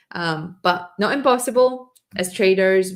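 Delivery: ordinary talk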